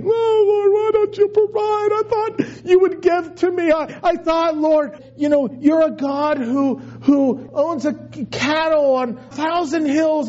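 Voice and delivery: hammed-up wail to the Lord; mock crying prayer. Preacher mocking a believer whining to God for money. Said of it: "And I'm crying".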